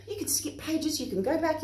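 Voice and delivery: usual speaking voice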